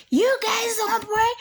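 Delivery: high, indignant mock shriek; retold outraged scream. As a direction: throw it back as a high, screamed challenge. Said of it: "I yelled and screamed".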